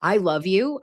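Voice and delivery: ordinary talk, nothing marked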